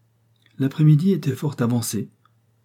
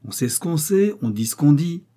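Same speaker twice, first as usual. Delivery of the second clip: lightly voiced old peasant, pitched up; hint of an old countryman, higher in pitch; 6 semitones higher than usual. A narrator's reading. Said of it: "reprit le vieux paysan". (vieux paysan à peine esquissé, voix haute)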